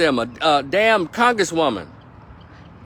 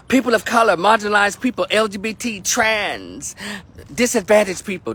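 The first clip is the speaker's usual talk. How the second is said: mocking buzzwords; 6 semitones higher than usual.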